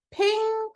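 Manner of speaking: high bright ping sound; a sharp high ping like a notification. A narrator's reading. Sound effect in her head.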